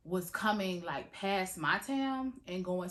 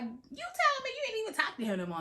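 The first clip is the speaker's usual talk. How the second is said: friend's disbelief; much faster than usual. Retold or imitated. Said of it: "the other friend like".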